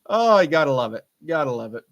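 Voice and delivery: ordinary talk, nothing marked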